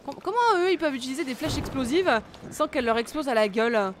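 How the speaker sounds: loud, outraged complaint; indignant outburst. Real, not acted. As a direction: rant at the unfairness. Outraged at unfair enemies.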